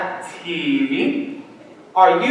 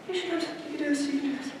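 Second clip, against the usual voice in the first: much softer, muttered to himself; nervous self-talk; 4 semitones higher, 16 dB quieter, much faster than usual. Real, not acted. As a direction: talk yourself into it under your breath. Psyching himself up, girl approaching.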